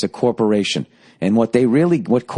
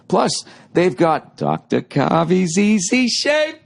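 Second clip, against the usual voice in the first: slow, higher, drawn-out announcer lead-in; slow build-up; 8 semitones higher, slower than usual. Acted. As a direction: draw it out before the big reveal. Building to the butter bit.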